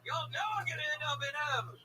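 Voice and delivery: exultant hollering. Real, not acted. Comic telling everyone he's heaven-bound, exultant hollering. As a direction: holler it like a convert at a revival.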